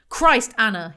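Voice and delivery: loud exasperated exclamation; angry outburst. A narrator's reading. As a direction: burst out at the wife in anger. Husband erupts, bangs fist on table.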